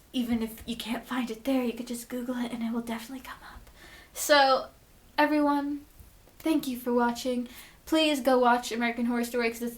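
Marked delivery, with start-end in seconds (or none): 0.1-3.7 fast whisper to the viewers; whispered aside. Real, not acted. Vlogger telling viewers where to find the show, quick whisper. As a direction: slip them the tip in a quick whisper.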